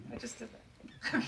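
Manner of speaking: slow, voiceless words through laughter; laughed-out aside; laughing. Real, not acted. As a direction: say it while dissolving into a real laugh. Laughing after own remark.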